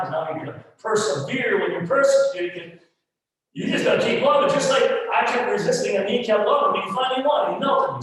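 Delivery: high, loud, impassioned testimony; full-force preaching. Acted. Pastor confessing his own pride to the church, preaching at full force.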